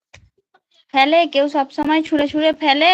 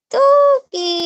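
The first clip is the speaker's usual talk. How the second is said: ordinary talk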